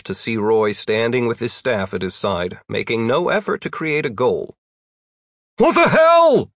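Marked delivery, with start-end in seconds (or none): 5.6-6.5 high-pitched incredulous yell; loud, high outburst; angry yell. A narrator's reading. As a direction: yell it in outrage from the air. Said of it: "Jeff yelled".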